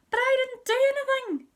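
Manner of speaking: high, loud protesting whine; indignant whine. Acted. Henry protests to Mum, high indignant whine. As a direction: whine it like it's so unfair.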